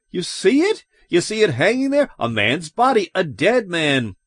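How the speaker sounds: high, agitated insistence; near-hysterical alarm, high-pitched; getting hysterical. A narrator's reading. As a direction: make them look, voice climbing. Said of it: "Lois began to get hysterical".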